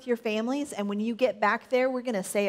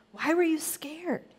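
higher, lilting storybook question; gentle questioning; 6 semitones higher than usual. A narrator's reading. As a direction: ask the frightened friends, without scolding. Jesus gently questions his friends.

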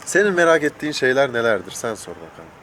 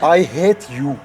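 laughing; 7 semitones higher, 4 dB louder, much slower than usual. Pretend. Mock hate line, laughing.

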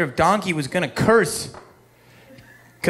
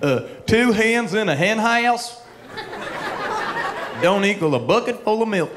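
folksy Doctor Phil; 4 semitones higher than usual. Retold or imitated.

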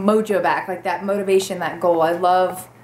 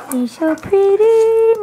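much higher and louder, slow gushing coo; 11 semitones higher, 9 dB louder, much slower than usual. Real, not acted. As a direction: squeal the compliment at the dog, drawn out. Complimenting the dog again.

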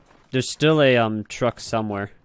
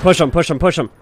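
loud, higher repeated urging; urgent shout; 6 semitones higher, 9 dB louder than usual. Real, not acted. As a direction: shout it at your teammate. Urging teammate during firefight.

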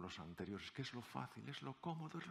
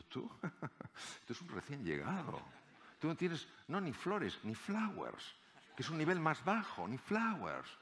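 scornful; 7 semitones higher than usual. Retold or imitated. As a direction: look down on the rookie. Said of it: "le miraron con desprecio". (colegas veteranos despreciando al recién llegado, con desdén)

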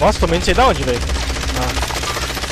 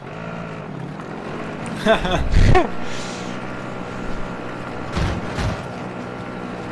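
long laugh; 4 dB quieter than usual. Real, not acted. Long laugh at game moment.

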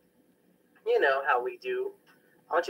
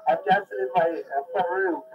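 giddy fan, pitched higher, squealing now and then; obsessive fan; 5 semitones higher than usual. Acted. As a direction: gush like a lovesick fan on a voicemail. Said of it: "that was my obsessive fan track".

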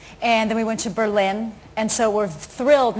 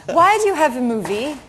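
lightly mimicked German accent, raised pitch; a hint of a German reporter's awkward question; mock German-accented query; German journalist; 4 semitones higher, 3 dB louder than usual. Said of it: "German, uh, journalists come in and say".

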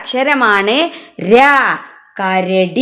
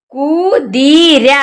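കുതിര drawn out very slowly, syllable by syllable; slow demo; much slower than usual. Shown as a demonstration. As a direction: stretch കുതിര slowly so the children follow. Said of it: "എന്താ വായിക്കുക?". (കുതിര വളരെ പതുക്കെ, അക്ഷരം അക്ഷരമായി)

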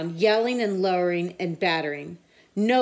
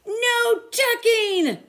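high, loud, very slow cry; crew cry; 5 dB louder, much slower than usual. A narrator's reading. Crew protests the bedtime request: loud slow cry. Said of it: "the crew cried".

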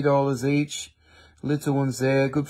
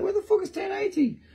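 high, fast mock-frantic whine; imitating own frustration; 5 dB quieter, faster than usual. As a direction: squeal it like you've searched all night. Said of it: "I've been like".

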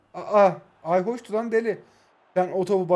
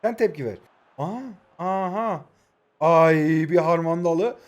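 ordinary delivery

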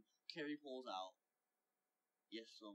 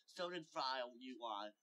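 ordinary delivery, nothing marked

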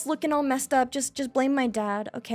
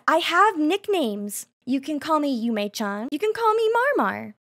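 normal talking voice

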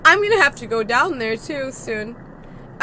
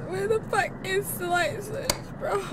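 ordinary talk

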